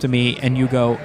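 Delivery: ordinary talk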